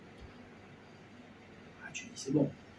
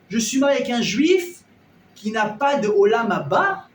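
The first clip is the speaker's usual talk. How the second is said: indignant wife; 5 semitones higher, 5 dB louder than usual. Acted. Esposa indignada ante su marido.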